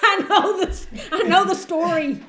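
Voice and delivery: high, loud words through laughter; laughing. Real, not acted. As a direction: crack up while saying you know it. Listeners crack up at the story.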